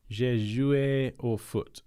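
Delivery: slow, careful schoolboy French; halting classroom French; bad French accent. Shown as a demonstration. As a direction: sound out rusty school French. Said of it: "My French is terrible".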